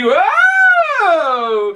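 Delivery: high, loud cry of alarm; startled whoa. Acted. Jack yelling as the snake rears back, high loud whoa. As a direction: yelp it out, hanging on for dear life. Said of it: "And then he".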